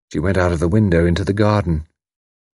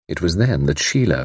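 usual speaking voice

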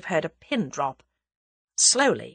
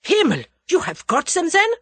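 put-on high, loud German-accented outburst; excited; 12 semitones higher, 6 dB louder than usual. A narrator's reading. German leans over Tommy, excited. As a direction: burst out with urgent excitement. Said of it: "his face purple with excitement".

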